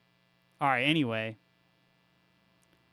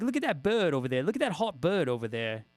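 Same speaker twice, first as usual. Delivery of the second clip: imitating British mates in a put-on accent. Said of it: "all my British friends would call".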